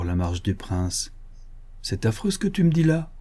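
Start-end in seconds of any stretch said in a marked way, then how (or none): 1.8-3.1 shocked exclamation. A narrator's reading. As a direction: exclaim in dismay with the pitch raised. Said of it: "s'écria-t-il".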